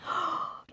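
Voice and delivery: sudden voiceless intake of breath; sharp gasp. Acted. Tina stops to think what she did, gasp. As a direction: gasp as if caught out.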